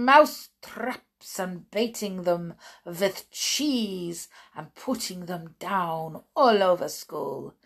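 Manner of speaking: put-on accented, gloating witch in full flow. Witch describing mousetraps all over England, gloating accented proclamation. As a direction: roll the words with relish, slowing to the finish.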